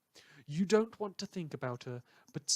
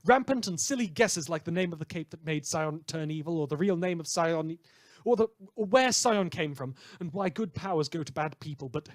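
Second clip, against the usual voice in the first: brash radio host; 2 semitones higher, 6 dB louder than usual. Acted. Radio host to his listeners, loud brash showman patter. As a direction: rattle off the tabloid news like a hyped DJ.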